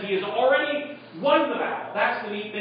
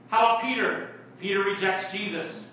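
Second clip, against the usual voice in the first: normal talking voice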